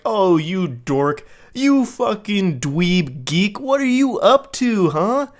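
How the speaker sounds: slow, high-pitched mock teasing; hammed-up flirty ribbing, stretched out; playful insults. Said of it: "like, the playful insults kind of thing".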